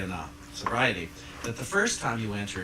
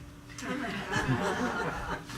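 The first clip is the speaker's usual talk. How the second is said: airy teasing laugh; breathy high laugh; 11 semitones higher than usual. Real, not acted.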